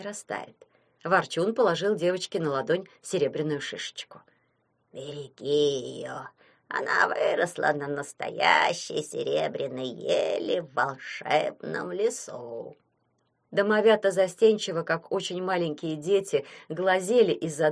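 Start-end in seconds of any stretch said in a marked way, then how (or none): 4.9-12.8 low, slow, hushed storybook voice; old forest grumbler. A narrator's reading. Vorchun giving the girl a magic cone, low and slow. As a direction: say it low and very slowly, with weight.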